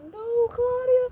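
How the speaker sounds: crying out at Claudia's fate; anguished cry. Real, not acted. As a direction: wail it in dismay.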